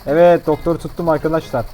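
usual speaking voice

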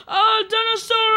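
high, wailing scared cry; high-pitched play-acted panic; pretend terror. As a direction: cry out at a monster. Figure screams at monster.

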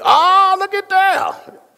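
high, drawn-out delighted ah; pleased surprise. Real, not acted. Preacher delighted his mic sound cleared, high slow exclaim.

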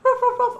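very high-pitched little dog barking; three high mock barks; imitated dog barks. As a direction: yap loudly like a small dog. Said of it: "he was barking the whole time".

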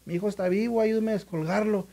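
high pleading insistence; imitating a distraught mother; desperate plea. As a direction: beg for help, clinging to hope. Mother insists son is alive.

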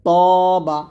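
তবা loud, with a heavy rounded first syllable; তবা with strong emphatic taw; thick ta. Shown as a demonstration. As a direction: hit a full, thick t in তবা. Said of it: "ত মোটা করতে হবে".

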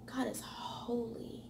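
slow, breathy hush on God is holy; reverent whisper. Acted. Preacher whispering God's holiness to listeners, hushed. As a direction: whisper it in awe.

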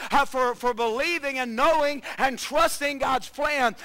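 slow, loud, high preaching cry; shouted preaching. Acted. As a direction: drive the challenge home at full voice. Preaching Jesus' challenge at full force.

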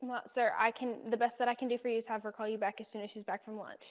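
ordinary talk, nothing marked

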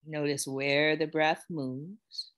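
calm, unhurried guiding voice with long pauses; guided meditation. Real, not acted. Yoga teacher guiding students to notice how they feel, meditative. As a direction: ease them gently into noticing body and breath. Leads a settling body check-in.

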